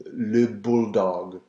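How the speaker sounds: bulldog drawn out slowly with French vowels. Shown as a demonstration. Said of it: "get away by saying".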